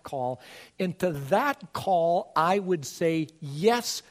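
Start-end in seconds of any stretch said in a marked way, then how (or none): none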